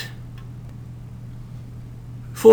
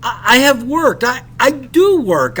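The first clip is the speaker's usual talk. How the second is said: higher, louder, stammered protest; impetuous cry; 6 semitones higher, 4 dB louder than usual. Acted. Narrator protesting to the captain like a defendant. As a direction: blurt it out defensively. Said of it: "I cried impetuously".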